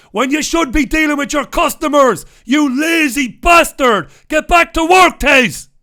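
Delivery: mock-furious shout across the road; screaming. Real, not acted. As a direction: bellow at a lazy friend to get back to work. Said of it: "Sorry about the screaming".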